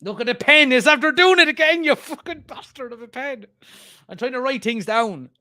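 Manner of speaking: high-pitched, real angry rant; exasperated outburst. Real, not acted. Streamer cursing a pen that fails while writing, exasperated. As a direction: explode at the thing, then stay high in exasperation.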